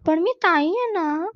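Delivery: put-on high whiny little-girl protest; whining. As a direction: whine it like a sulking big sister. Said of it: "Anu कुरकुरली".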